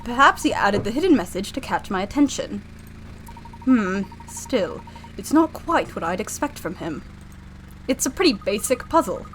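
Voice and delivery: musing professor in a put-on British accent; pondering British professor. Professor doubts his friend's puzzle, pondering British voice. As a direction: think it through aloud as the British professor.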